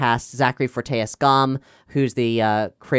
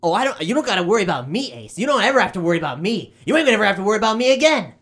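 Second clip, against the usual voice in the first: fast, higher, mocking teen; sneering kid brother; 8 semitones higher, faster than usual. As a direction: brush him off with a sneer.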